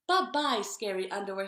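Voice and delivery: bunny mailing the underwear to China; triumphant goodbye. A narrator's reading. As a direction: wave the scary underwear off. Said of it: "he said".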